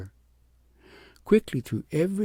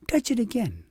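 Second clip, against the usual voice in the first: much higher, urging; child urging princess to try again; eager little girl; 10 semitones higher, 4 dB louder than usual. A narrator's reading. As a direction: come on, once more.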